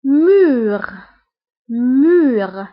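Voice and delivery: teacher modelling مور as French mur; French word model. Shown as a demonstration.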